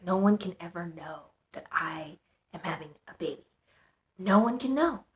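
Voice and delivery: whispered, fearful vow of secrecy; secret whisper. Retold or imitated. Scared girl swearing nobody will know, whispering. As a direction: hush it like a hidden shame. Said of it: "I was like".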